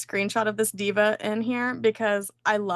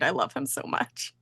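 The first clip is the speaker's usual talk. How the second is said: ordinary delivery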